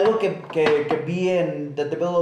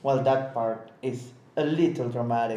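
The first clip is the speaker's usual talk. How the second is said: deadpan; 6 semitones lower, 5 dB quieter, slower than usual. Retold or imitated. Quoting Tenet dialogue deadpan.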